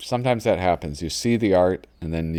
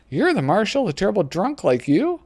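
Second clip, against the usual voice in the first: put-on girl voice; 12 semitones higher than usual. Girl retorts in comic.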